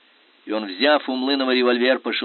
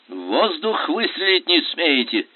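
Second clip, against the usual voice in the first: slow, mocking question; lightly mocking adjutant; 6 semitones higher, 4 dB louder, slower than usual. A narrator's reading. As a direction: needle the coward. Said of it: "насмешливо спросил Млынов".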